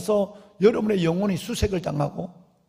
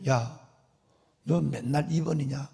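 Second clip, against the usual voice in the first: lower, softer voice of God chiding; a lightly voiced God teasing a believer; God's rebuke; 7 semitones lower, 6 dB quieter than usual. God scolding the believer who always puts him second, low and chiding.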